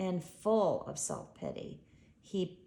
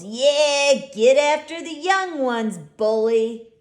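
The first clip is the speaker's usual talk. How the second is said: higher, much louder cackling taunt; hyena jeer; 7 semitones higher, 14 dB louder than usual. A narrator's reading. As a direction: goad him like a mocking hyena. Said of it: "Yet another hyena chuckles".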